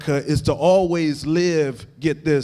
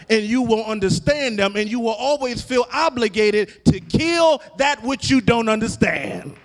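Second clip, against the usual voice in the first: rising, shouted and half-sung preaching, much higher and louder; full-force preaching; 6 semitones higher, 4 dB louder than usual. Acted. Preacher building to full cry.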